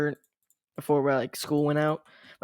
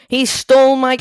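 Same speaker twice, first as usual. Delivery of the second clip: loud, high indignant yell; angry outburst; 9 semitones higher, 12 dB louder than usual. Real, not acted. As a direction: shout at the kill thief. Teammate steals his game kill.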